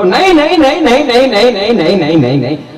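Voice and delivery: high bride's cry sliding steadily down; hammed-up repeated shriek, falling in pitch; mock wail. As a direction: refuse over and over in a comic wail. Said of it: "છોકરીએ રાડ નાખી હો".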